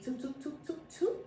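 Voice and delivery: toots climbing high into a squeak; sing-song toots rising up; playful rising toots. Pretend. Playful sound-effect ending.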